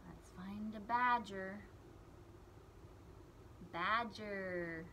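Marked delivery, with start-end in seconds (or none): none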